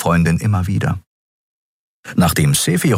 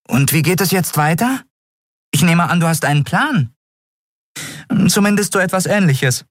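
higher, dry questioning friend; lightly voiced Nelen; 12 semitones higher than usual. A narrator's reading. Nelen teasing Endriel about her plan, higher voice.

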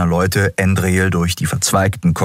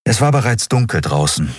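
ordinary delivery

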